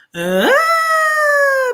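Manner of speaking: high, loud whooping squeal; laughing shriek. Real, not acted. Reader cracking up at own joke, high loud shriek.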